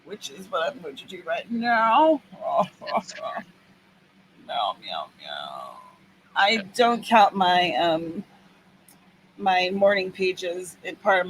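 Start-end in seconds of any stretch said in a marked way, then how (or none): 4.4-5.8 cat meows. Pretend. Cat noises while waiting.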